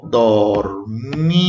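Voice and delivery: "dormí" very drawn out, dor... míii; split into syllables. Shown as a demonstration. Teacher dividing a word for pupils, "dormí" stretched syllable by syllable. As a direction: stretch both syllables of "dormí" long and low. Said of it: "la siguiente sílaba es mi".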